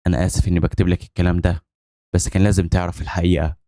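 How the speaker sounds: ordinary delivery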